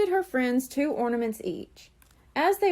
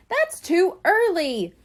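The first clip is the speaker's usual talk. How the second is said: high, slow, louder kitten protest; yelled protest; 7 semitones higher, 5 dB louder, slower than usual. A narrator's reading. Coral yelling to her mothers, loud and slow. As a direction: wail that it's far too early. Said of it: "Coral yelled".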